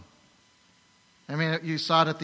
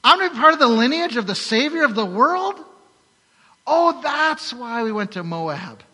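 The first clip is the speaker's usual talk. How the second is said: high, loud disbelieving cry, easing off; astonished Naomi; 9 semitones higher, 10 dB louder than usual. Retold or imitated. Naomi in heaven sees the Savior's lineage, astonished shout. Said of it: "Naomi in heaven was going".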